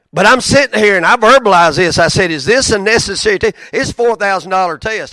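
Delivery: loud and high, exasperated; loud heated preacher rant; loud exasperation. Real, not acted. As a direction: let the frustration boil over. Preacher retelling a frustrated call.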